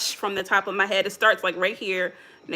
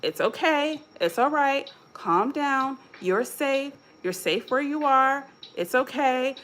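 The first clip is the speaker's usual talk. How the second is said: soft, higher, calming words to yourself; soothing self-talk; 4 semitones higher, 5 dB quieter than usual. Shown as a demonstration. Speaker showing listeners how to calm themselves, softer and higher soothing. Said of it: "you can say".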